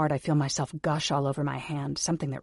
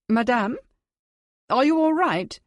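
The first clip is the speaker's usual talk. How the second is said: put-on high, louder, worried call with a slight foreign accent; nervous maid; 9 semitones higher, 6 dB louder than usual. Maid worrying at the locked door, high and nervous. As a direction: call through the door, anxious. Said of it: "Mary sounds extra nervous in that not quite English accent".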